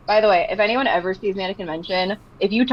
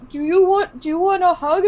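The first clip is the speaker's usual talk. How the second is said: tender offer; 10 semitones higher, 4 dB louder than usual. Retold or imitated. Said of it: "I'm like".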